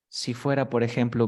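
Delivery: ordinary talk, nothing marked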